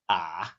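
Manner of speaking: "Ah" dipping low, then up; low, drawn gasp of "Ah" in mild surprise; third tone surprise. Acted. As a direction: sink "Ah" deep and scoop it back. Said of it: "Third tone, deep in surprise".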